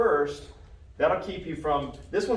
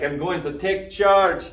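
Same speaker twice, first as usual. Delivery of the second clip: put-on loud, drawn-out, higher stab at an Irish accent; attempted Irish accent; 4 semitones higher, 10 dB louder, much slower than usual. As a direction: put on a big, slow Irish accent, not quite landing it. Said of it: "trying to do an Irish accent".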